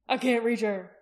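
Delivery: loud call at ordinary pitch; shouting. A narrator's reading. Jaqen shouting to Aki in the cave, loud call. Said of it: "Jaqen shouted to Aki".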